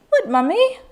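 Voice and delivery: put-on high British child's reply; English kids' accent. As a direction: imitate a British kid, questioning. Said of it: "Why are you talking like that?".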